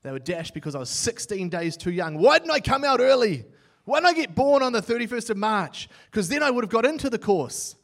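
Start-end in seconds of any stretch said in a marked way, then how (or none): 2.2-7.8 louder, higher, half-joking complaint; mock frustrated outburst. Preacher recalls missing the course by days, mock frustrated outburst. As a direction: throw up your hands in playful exasperation.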